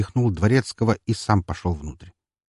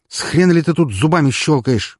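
raised, louder indignant challenge; indignant outburst; 8 semitones higher, 6 dB louder than usual. Acted. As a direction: snap at the beast in outrage. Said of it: "возмутился я".

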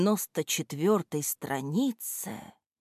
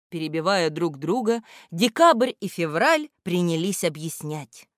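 ordinary delivery, nothing marked

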